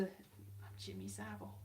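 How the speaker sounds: very low, slow, hushed impression; mock Jimmy Savile. Vlogger sliding into another impression, low hushed mock voice. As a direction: drop deep and quiet, drawn out. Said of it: "Jimmy Savile from around there".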